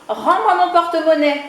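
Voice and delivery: high, loud shout of a small chick; shouting with all his might. Acted. Chick yelling at the big man for his purse. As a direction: yell it up at the big man's door. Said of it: "Poussin crie de toutes ses forces".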